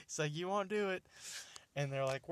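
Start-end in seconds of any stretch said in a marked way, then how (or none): 0.3-1.0 higher-pitched mock challenge; retold defiant dare. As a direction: imitate someone calling their bluff. Retold defiant reply in argument.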